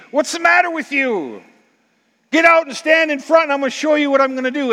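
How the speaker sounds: God's exasperated call out of the cave; God rebuking. Acted. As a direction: shake him out of hiding. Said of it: "Elijah hears him say".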